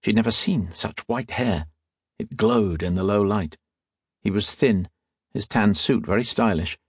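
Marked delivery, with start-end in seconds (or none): none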